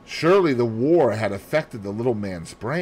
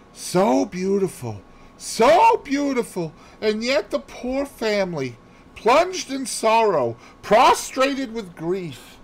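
high, slow, a touch of mock sorrow; sorrowful; 12 semitones higher than usual. Poirot mourns the family while testing Hastings, mildly sorrowful. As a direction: lament it while watching him closely. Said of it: "gazed sorrowfully".

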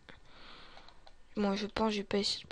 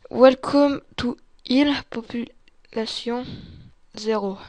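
drawn out word by word; slow sign reading; 10 dB louder, slower than usual. Real, not acted. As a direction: read the sign out slowly and loudly. Reads out an English sign.

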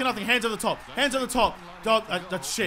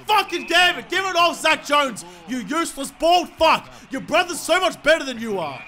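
raised, high-pitched frustrated rant; real exasperated swearing; angry berating; 4 semitones higher than usual. Real, not acted. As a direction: tear into a useless teammate. Berates Zach Jones.

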